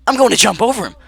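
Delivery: loud, high burst of resolve; hyped-up decision; excited yell. Retold or imitated. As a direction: shout it like a sudden plan. Kid's bold decision retold.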